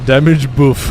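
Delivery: put-on "Damage buff" in a light French accent; a hint of French chef saying "Damage buff"; lightly accented French "Damage buff". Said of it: "sounds like a French chef".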